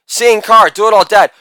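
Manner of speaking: normal talking voice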